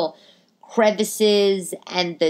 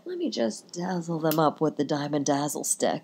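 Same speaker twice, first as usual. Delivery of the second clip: mock fancy voice; 2 semitones lower, 7 dB quieter than usual. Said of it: "and I was like".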